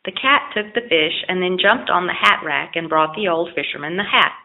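ordinary delivery, nothing marked

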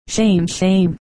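ordinary delivery, nothing marked

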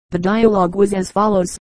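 ordinary delivery

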